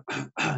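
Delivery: a quick, hard throat clear; throat clearing demo. Shown as a demonstration. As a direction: show the class a real throat clear. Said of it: "every time you clear your throat".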